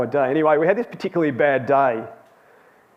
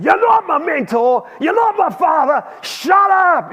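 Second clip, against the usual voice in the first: loud, high-pitched yell, acted out; retold furious outburst; 14 semitones higher, 5 dB louder than usual. Crewmate shouting back at his colleague in the truck, re-enacted furious outburst. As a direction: re-enact a crewmate exploding at you. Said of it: "he blew up and he said".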